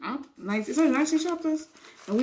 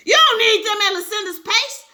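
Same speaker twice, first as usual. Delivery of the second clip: much higher, louder screamed repeat; 9 semitones higher, 6 dB louder than usual. Acted. Wife screaming at departing husband. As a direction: scream it back at him. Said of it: "she screamed".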